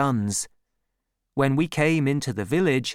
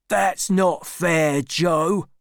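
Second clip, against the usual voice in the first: slow, higher resentful grumble; complaining; 5 semitones higher, 3 dB louder, much slower than usual. Acted. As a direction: whine that it's unfair. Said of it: "complained Orlick".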